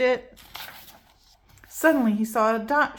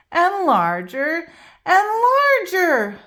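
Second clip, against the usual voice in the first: growing excitement; 9 semitones higher, 7 dB louder than usual. A narrator's reading. Alligator grows in aquarium.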